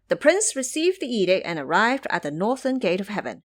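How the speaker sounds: ordinary delivery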